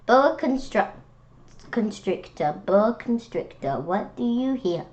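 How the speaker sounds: lively, sing-song picture-book read-aloud; reading to children. Acted. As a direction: read it like storytime for little kids.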